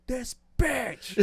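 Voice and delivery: sudden high yelled curse; angry shout. Retold or imitated. Friend mimics Eric cursing the driver, shouting. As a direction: yell it like you just got hit.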